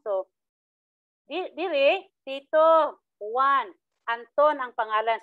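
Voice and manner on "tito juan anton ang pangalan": Tito louder, then pauses after Tito and Juan; pauses between names. Shown as a demonstration. Teacher showing pauses that separate Tito, Juan and Anton. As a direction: break between each name. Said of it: "apat na sila".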